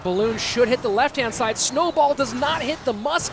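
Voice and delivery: excited play call. Real, not acted. Caster calls the key play.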